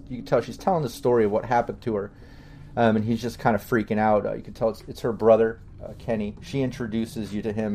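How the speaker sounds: usual speaking voice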